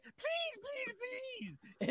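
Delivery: high, fading to a whisper; hammed-up frightened whimper; mock desperate plea. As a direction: beg the handlers in fake fright. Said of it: "she was like".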